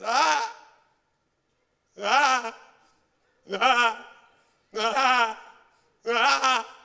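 loud mock "ah" of pain on each step; imitated hobbling cries of pain, evenly spaced; exaggerated ow-it-hurts yelp; pained limping groan. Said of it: "begins to walk to the sign in the back like this".

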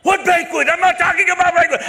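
very high, loud, outraged scammer yell; hammed-up screaming scammer; imitated screaming. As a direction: yell in baffled fury at the caller. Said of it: "I, I'm screaming".

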